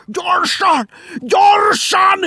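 high, full-throated alarm shout; urgent roar, far above normal pitch; yelling at the top of the lungs. A narrator's reading. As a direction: bellow it with everything you have. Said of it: "torka szakadtából ordít".

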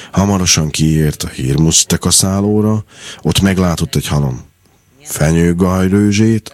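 plain reading voice

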